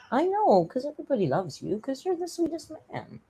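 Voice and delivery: high, soft cooing pet voice; baby talk. Acted. Streamer cooing to her cat that he is loved. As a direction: coo sweetly up high.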